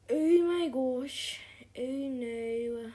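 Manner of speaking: gasp, then drawn-out loud panic; startled scream. Real, not acted. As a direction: shriek in sudden fright. Killer suddenly appears.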